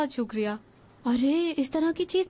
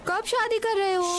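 higher-pitched nagging question; raised pitch, pestering insistently; 5 semitones higher than usual. Acted. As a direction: pipe up higher, pressing for an answer. Pestering, then gets shushed.

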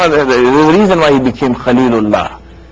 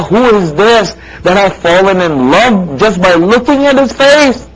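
higher, fervent preaching voice, building; impassioned preaching; 8 semitones higher, 4 dB louder than usual. Acted. Preacher voicing Ibrahim's plea to Allah, fervent preaching. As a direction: pour out Ibrahim's wonder to the listeners.

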